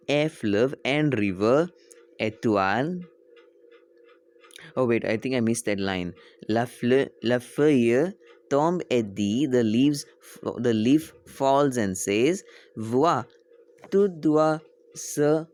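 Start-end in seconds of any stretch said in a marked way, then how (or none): 0.1-3.0 slow, spaced-out teacher reading; slow read-aloud. Shown as a demonstration. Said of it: "why I'm reading slow". 6.5-15.5 slow, louder word-by-word reading; slow read-aloud. Shown as a demonstration. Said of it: "why I'm reading slow".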